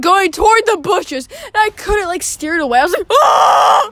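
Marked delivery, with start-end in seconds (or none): 3.1-3.9 loud, high scream; retold scream. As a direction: let out a dramatized scream. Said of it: "I literally screamed".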